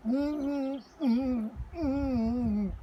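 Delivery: high muffled mms through a mouthful; boy trying to answer through chocolate; murmuring with mouth full. Acted. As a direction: hum it with cheeks stuffed with chocolate. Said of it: "he murmured".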